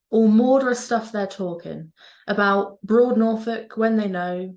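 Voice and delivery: broad Norfolk dialect, slow recital; Norfolk accent. Shown as a demonstration. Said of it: "a poem written in my mother language, the Norfolk dialect".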